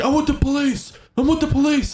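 imitating a man yelling through the car door; shouted claim. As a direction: call it out over and over. Armed man shouting at driver.

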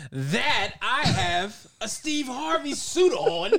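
very slow, high, loud courtroom flourish; mock lawyer voice. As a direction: stretch every word like a showman lawyer. Continues mock courtroom joke.

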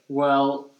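usual speaking voice

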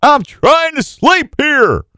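high, slow, put-on wife voice; mock whiny plea from the wife woken early; imitating his wife. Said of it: "Jenny's over there going".

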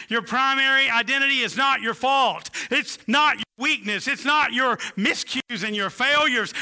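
building sermon declaration. Acted. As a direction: hammer each "not" harder, building to a loud climax. Preacher builds repeated declaration.

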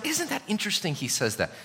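ordinary talk

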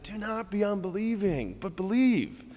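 gentle pleading. Shown as a demonstration. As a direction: coax gently, like a loving friend. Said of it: "the tone makes the difference".

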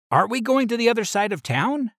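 high called-out question while running; shouted question. Acted. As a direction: yell it back over the shoulder.

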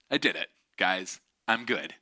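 mild, low-key complacency; complacent. Retold or imitated. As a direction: lightly act a swimmer content with herself.

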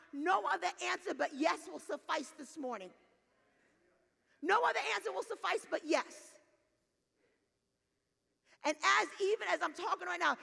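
loud, high, emphatic sermon delivery; impassioned preaching. Real, not acted. Preacher urges congregation toward yes, impassioned. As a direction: drive it home at full force.

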